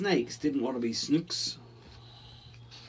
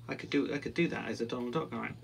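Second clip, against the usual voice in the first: plain reading voice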